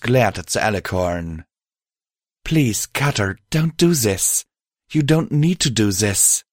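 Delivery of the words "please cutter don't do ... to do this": higher-voiced mare, pleading. Mare begging her friend not to do this, pleading. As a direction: plead with him in a raised pitch. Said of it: "pleading with my host".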